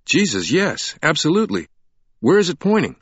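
frantic, pushing up with each phrase; wild excitement. A narrator's reading. The man, eyes wild, urgently asks Sienna where it points, frantic. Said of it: "The man's eyes were wild now".

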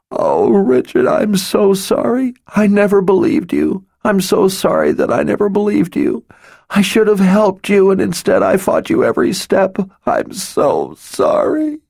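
sobbing. A narrator's reading. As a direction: plead through tears, voice cracking. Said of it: "racking sobs".

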